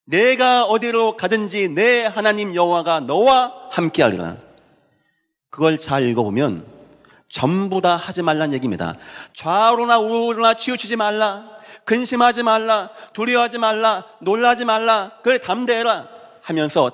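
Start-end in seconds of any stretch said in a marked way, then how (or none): 0.0-4.4 slow, high proclamation of God's promise; scripture declamation. Acted. Preacher quotes Joshua's promise to the church, slow declamation. 9.3-16.1 high, insistent chain of commands; commanding declamation. Acted. Preacher lists God's commands to Joshua, commanding declamation.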